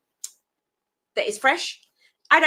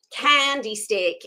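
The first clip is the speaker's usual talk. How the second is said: put-on slow, playful American accent; put-on American accent, unhurried; slower than usual. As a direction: say it with a drawn-out American twang. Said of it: "that was my American accent".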